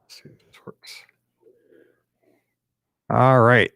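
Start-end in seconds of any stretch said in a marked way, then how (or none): 0.1-1.0 whispered to himself. Real, not acted. Host checking the link, whispering to himself. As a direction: murmur it under your breath. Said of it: "whispering while setting up".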